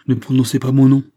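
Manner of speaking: loud, high, angry command; interdiction indignée. Acted. Elle lui interdit son prénom. (ordre indigné, voix haute et forte)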